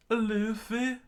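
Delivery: very high, questioning voice; surprised squeak. Acted. Chopper looking up at Luffy, high surprised voice. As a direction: squeak the name in wonder.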